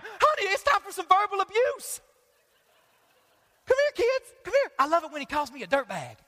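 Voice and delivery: high, hammed-up eager calling; mock eager dad. Dad calling wife and kids to hear the collector, mock eager. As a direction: call the family over in mock delight. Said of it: "gather the wife and kids around".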